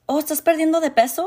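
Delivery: high, fast fake-concerned auntie; Spanish aunt mocked, high and quick; mock nosy relative. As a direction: imitate the aunt's prying question quickly. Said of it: "they use those comments like".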